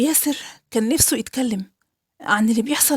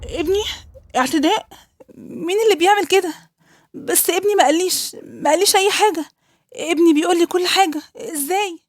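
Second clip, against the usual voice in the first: higher, stunned and upset questions; a mother who can't believe the news; pitched up in horrified shock; shocked disbelief; 5 semitones higher than usual. Acted. Mother hears son was abused.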